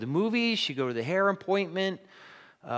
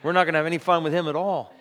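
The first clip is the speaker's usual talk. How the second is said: dismissive brush-off; 7 dB louder than usual. Pretend. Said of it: "blow it off and say".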